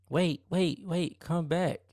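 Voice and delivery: high call down the hallway; high mock call to come back. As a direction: call after him, pitched high. She calls after him.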